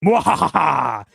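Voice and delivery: slow, loud villain laugh; evil cackle. Pretend. Takes over chat, villain laugh.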